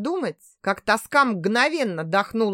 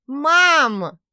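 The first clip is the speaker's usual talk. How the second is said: loud, higher repeated call; called out again; 5 semitones higher, 6 dB louder than usual. Acted. Daughter repeating her greeting to mother, loud call. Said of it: "повторила Ирка".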